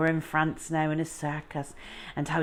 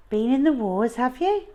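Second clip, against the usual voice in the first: higher, louder teasing question; teasing adult; 7 semitones higher, 5 dB louder than usual. A narrator's reading. Narrator teasing the runaway boy, high and bright. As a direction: tease him kindly about his scrapes.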